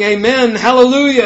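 high, loud exultant praise; rising shout of praise; worshipful proclamation. A narrator's reading. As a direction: lift it up as a loud acclamation. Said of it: "They were saying".

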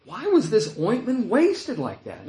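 acted-out indignant questioning. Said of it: "They're angry".

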